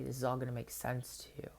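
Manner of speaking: quick soft whisper of a promise; whispered comfort. Retold or imitated. Promising his inner child a future, quick whisper.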